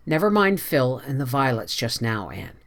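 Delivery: ordinary delivery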